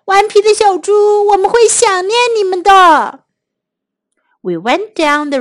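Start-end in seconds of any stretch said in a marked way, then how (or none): 0.1-3.2 high, loud storybook wail; tearful calling out. A narrator's reading. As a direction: wail a farewell to departing friends. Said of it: "所有的动物都在哭喊".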